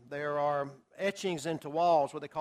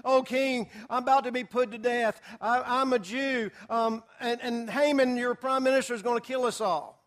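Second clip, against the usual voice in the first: mock sobbing plea; 5 semitones higher, 5 dB louder than usual. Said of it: "she started sobbing and crying and saying".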